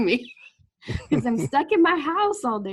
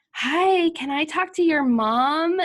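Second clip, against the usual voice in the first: mock telemarketer, pitched up and louder; chirpy caller asking for mom; a salesman on the phone, hammed up a bit; 6 semitones higher, 6 dB louder, slower than usual. Telemarketer voiced in story.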